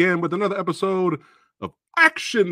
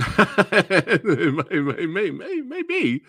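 laughing; 4 semitones higher, 3 dB louder, much slower than usual. Real, not acted. Laughing while teasing controversy.

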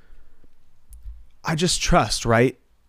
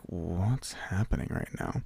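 baffled hushed mutter; 4 semitones lower, 12 dB quieter, slower than usual. Retold or imitated. The boys baffled by stranger.

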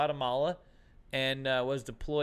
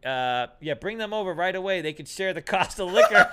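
louder, laughing eager retort; mock eager; 3 semitones higher, 7 dB louder than usual. Teller mocking his eager reply to the bouncer, laughing. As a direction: push it eagerly through a laugh.

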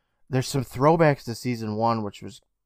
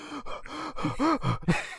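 frightened gasp; 8 dB quieter than usual. Retold or imitated. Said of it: "Kiki's just sitting there like".

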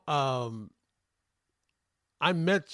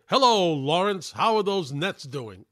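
booming broadcaster greeting, louder; radio voice; 4 semitones higher, 6 dB louder than usual. Retold or imitated. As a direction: call it out like a radio announcer. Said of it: "in his radio voice".

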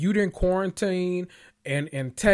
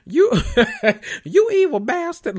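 mock outraged viewer; 14 semitones higher, 6 dB louder than usual.

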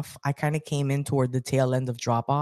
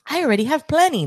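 put-on higher, louder little-girl voice; a kid's voice pitched high and loud; imitating daughter; 11 semitones higher, 6 dB louder than usual. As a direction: mimic the kid's matter-of-fact claim, pitched up. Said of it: "she's just like".

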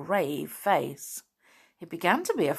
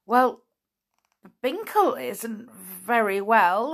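hesitant, slow, pitched up; stammering; 5 semitones higher, 5 dB louder, slower than usual. A narrator's reading. Flip answers the doctor, stammering a little. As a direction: stall after the first word, mildly flustered. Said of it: "he stammered at last".

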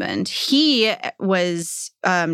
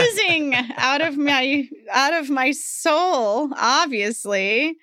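slow mock drama; 6 semitones higher, slower than usual. Real, not acted. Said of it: "self-mocking exaggeration".

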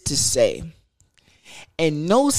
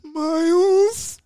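high, drawn-out plea of "Miles"; girl's pleading voice; 14 semitones higher than usual. Retold or imitated. The girl on the phone at two a.m. calling his name, high and pleading. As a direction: call his name like a scared girl, much higher.

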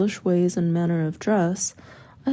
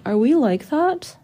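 mild cry; 6 semitones higher, 4 dB louder than usual. A narrator's reading. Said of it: "I cry".

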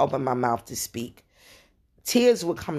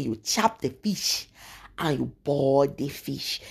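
soft, hushed African auntie voice; lightly voiced African auntie, softer; African accent; 3 dB quieter, slower than usual. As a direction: lean in gently with an African lilt. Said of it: "She said".

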